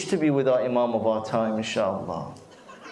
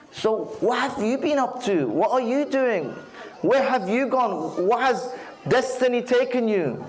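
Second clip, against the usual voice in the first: lightly acted guitar, much higher pitch; high-pitched, curious questioning; 10 semitones higher than usual. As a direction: quiz an old friend in a high, lilting pitch. Guitar quizzes the minbar in anecdote.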